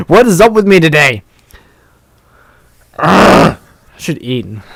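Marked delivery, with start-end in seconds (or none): 0.1-1.2 loud, high-pitched self-frustrated outburst; exasperated. Real, not acted. Exasperated at own stumbling over reading. 3.0-3.6 loud exasperated "ugh"; frustrated groan. Real, not acted. Exasperated at own stumbling over reading.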